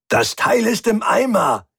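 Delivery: high, exasperated snap; annoyed outburst, pitched right up; harried and pissed-off. A narrator's reading. Mechanic reports broken part to captain, harried and pissed-off. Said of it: "zu gleichen Teilen gehetzt wie angepisst".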